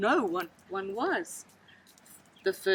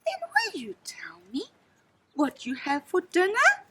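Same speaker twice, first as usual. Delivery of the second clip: gasped question; 7 semitones higher than usual. A narrator's reading. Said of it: "gasped the elephant child".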